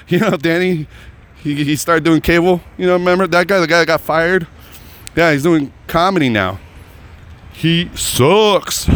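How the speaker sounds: high-pitched imitation of gossip; mock gossiping friends. Friends back in Reno tell everybody, high mocking gossip.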